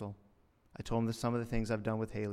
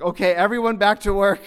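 louder, higher, mock-hurried order from the manager; flustered manager; 8 semitones higher, 12 dB louder than usual. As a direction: shoo everyone back like a rattled boss. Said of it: "It's like".

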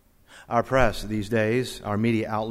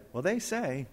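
a hint of a gossiping believer, pitched up; lightly voiced gossip; 6 semitones higher, 5 dB quieter than usual. Believer passing on rumors, lightly mimicked gossip. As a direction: lightly imitate someone passing on hearsay, higher. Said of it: "When we as believers say".

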